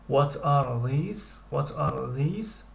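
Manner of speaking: drawn-out, careful question; slow model question. Shown as a demonstration. Teacher drills the plural question slowly. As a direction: stretch each word for learners. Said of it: "teacher models question".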